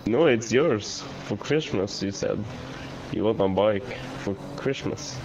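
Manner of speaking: ordinary delivery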